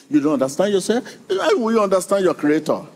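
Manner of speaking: ordinary delivery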